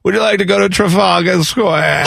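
lightly put-on British voice; British cab driver giving a tour, mock accent. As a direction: play a chatty London cabbie. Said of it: "What does it sound like?".

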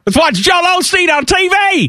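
high, loud hammed-up yell; loud mocking outburst; mock shout. Continuing the mock accent bit.

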